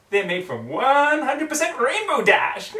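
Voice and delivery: very high, loud and slow sing-song; creepy Pinkie. Acted. Pinkie teasing Twilight with a creepy high sing-song. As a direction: drag it out sweetly and unhinged.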